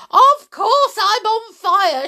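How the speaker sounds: put-on high, loud, exasperated old woman; indignant yell. A narrator's reading. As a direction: yell back as if it were obvious. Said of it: "she yelled".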